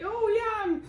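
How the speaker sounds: high-pitched, loud acted cry; hammed-up shriek of pain; mock pained yell. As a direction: wail it like a cartoon burn victim. Acting out character burning his hand.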